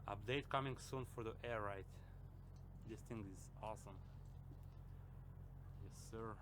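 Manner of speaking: talking through braces; words slurred by braces. Real, not acted. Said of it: "I'm sorry for my talk. I'm wearing braces".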